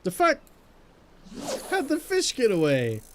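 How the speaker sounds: high startled outburst; surprised. Real, not acted. Fish escapes suddenly.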